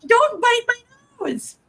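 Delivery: high-pitched, drawn-out pet scolding; playful scolding. Real, not acted. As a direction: scold the cat in a high, stretched-out voice. Scolding a cat biting her.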